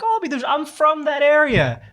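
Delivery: high-pitched amazed reply; surprised recognition. Retold or imitated. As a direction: act out delighted coincidence, voice way up. Quoting his surprised reply to her.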